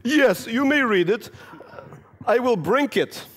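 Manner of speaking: put-on high, slow, careful Russian kid accent; slow, high Russian child voice. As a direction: speak like a stiff little Russian boy.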